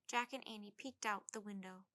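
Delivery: plain reading voice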